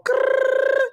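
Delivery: high playful grrr. Retold or imitated. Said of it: "on my [name] shit".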